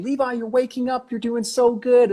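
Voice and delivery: high, slowed imitation of a wife's voice; wife calling out. Wife to husband waking from sedation, high encouraging call. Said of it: "I hear this voice calling out".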